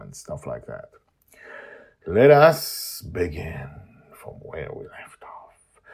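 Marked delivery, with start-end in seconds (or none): 2.1-5.8 dramatic story opening. Acted.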